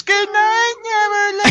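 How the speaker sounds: very high, put-on little kid voice; squeaky mock-child sign-off; kid's voice. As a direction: pipe it up high like a child in a story. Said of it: "being the kid that goes".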